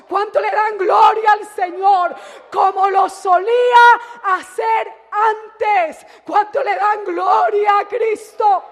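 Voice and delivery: shouted, rising call to the congregation; fiery preaching. Acted. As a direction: stir the crowd to praise. (predicación a gritos)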